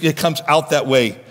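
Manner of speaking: usual speaking voice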